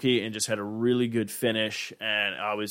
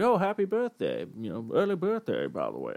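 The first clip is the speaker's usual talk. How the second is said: put-on old shop clerk; 4 dB quieter than usual. Retelling the liquor store clerk's birthday greeting, old-man voice. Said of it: "I don't know why he sounds like that".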